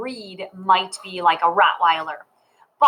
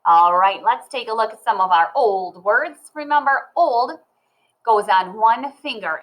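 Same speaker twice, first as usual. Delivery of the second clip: ordinary talk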